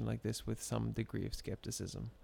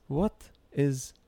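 put-on slow, louder, heavy Arabic accent; 3 semitones higher, 6 dB louder, slower than usual. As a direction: ask it grandly in Arabic accent. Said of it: "a non-nuanced Arabic accent".

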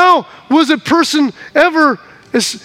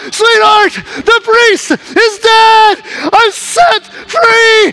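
high, loud and slow, overjoyed; freed man calling to his wife at the farmhouse; joyful call to wife; 6 semitones higher, 3 dB louder, slower than usual. Acted. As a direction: savour each word of freedom. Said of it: "and you go".

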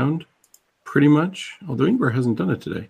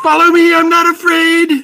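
high, loud heroic yell; brave shout; 8 dB louder than usual. Retold or imitated. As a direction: shout it out fearlessly. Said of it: "Mouse is like".

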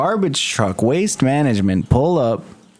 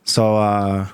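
usual speaking voice

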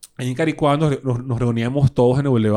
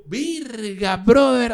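high-pitched retold amazement; asombro actuado; 8 semitones higher, 5 dB louder, much slower than usual. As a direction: relive being blown away, high-voiced. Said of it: "Y yo". (asombro imitado, en voz aguda)

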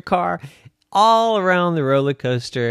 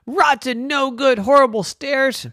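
put-on high, cross little boy scolding the stairs; grumpy kid insult; 11 semitones higher than usual. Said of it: "and he said".

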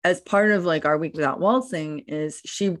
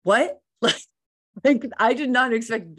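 surprised what; 7 semitones higher than usual. Retold or imitated. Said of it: "she was like".

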